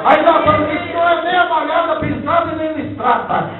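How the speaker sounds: loud fervent preaching; preaching at full force. Acted. (pregação em alto volume, com fervor)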